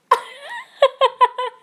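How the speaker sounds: high, loud cackling laugh; burst of laughter. Real, not acted. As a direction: break out laughing at the screen. Laughs at stuck player.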